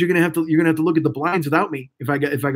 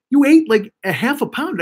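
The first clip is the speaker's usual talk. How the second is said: retold disbelief; 8 semitones higher than usual. Friend's shocked reaction, retold.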